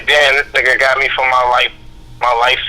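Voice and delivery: ordinary talk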